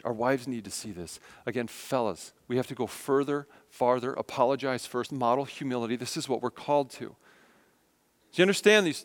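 usual speaking voice

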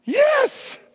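high, exultant cry of yes; dad's joyful yes. Retold or imitated. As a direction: imitate dad lifting his hand and rejoicing. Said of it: "My dad lifts his hand and goes".

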